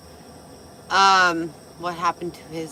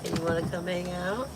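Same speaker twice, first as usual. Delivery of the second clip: lightly sultry invitation, a touch softer; sultry come-on; 4 dB quieter, faster than usual. Pretend. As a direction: tease them into hanging out, mock seductive. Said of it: "I'm suddenly so sexual".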